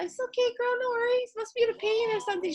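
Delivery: high, quick, put-on reassuring voice; mock reassuring. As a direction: brush it off sweetly and fast. Reassuring the client, retold.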